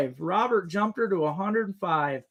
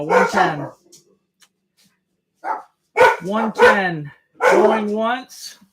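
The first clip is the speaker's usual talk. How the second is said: louder auctioneer calling out the bid; 7 dB louder, much slower than usual. Real, not acted. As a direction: call the final bid out loud to the room. Auctioneer closing the bidding.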